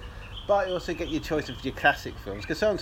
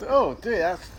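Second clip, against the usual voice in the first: ordinary delivery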